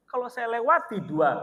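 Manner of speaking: usual speaking voice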